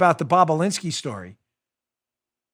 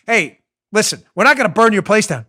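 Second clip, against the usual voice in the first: mob enforcer; 4 semitones higher, 6 dB louder than usual. Retold or imitated. Mob enforcer reassuring with a threat.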